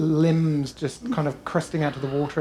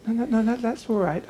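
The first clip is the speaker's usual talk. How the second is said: lightly voiced pixie; 8 semitones higher, 4 dB louder than usual. Pixie answers the player's thanks.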